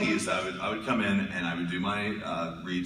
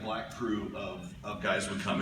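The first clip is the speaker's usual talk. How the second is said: normal talking voice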